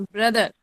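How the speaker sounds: ब्रदर said as "bruh-dar", the O not sounded; ब्रदर with the vowel of but. Shown as a demonstration. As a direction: say ब्रदर with a short "uh" first vowel. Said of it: "वो अ की sound निकलती है".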